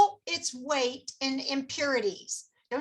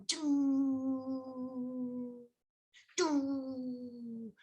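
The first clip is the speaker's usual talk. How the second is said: long, sustained "doo" drone; imitated sci-fi hum; 9 dB quieter than usual. Shown as a demonstration. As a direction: hum out a drawn-out movie sound effect. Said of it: "sound like you're gonna be going".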